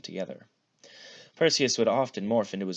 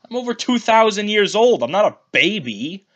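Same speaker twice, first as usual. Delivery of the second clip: much higher, louder whine; whining; 13 semitones higher, 13 dB louder than usual. A narrator's reading. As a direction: whine like an indignant teen.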